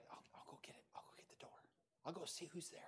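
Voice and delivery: hushed whisper, careful not to interrupt prayer; whispered offer. Retold or imitated. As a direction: whisper it as Rhoda slipping away from prayer. Rhoda offering during prayer meeting.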